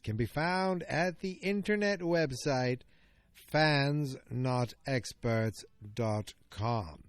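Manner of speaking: slow, drawn-out announcer read; slow promo voice for the website; announcer plug. Acted. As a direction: sell the web address like a radio ad. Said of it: "announcing his website".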